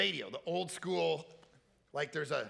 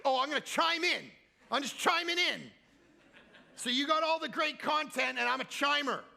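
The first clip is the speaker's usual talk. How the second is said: higher, mock-offended sarcasm; imitating his wife's sulky sarcasm; annoyed sarcastic wife; 9 semitones higher, 3 dB louder than usual. Wife mocking his chime in plan, sarcastic imitation. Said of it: "she was not happy".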